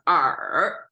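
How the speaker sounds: er drawn out, falling then rising; third tone on er. Shown as a demonstration. Teacher explaining the tone, falling-rising third tone on er. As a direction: dip er down, then lift it back up. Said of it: "this is a third sound".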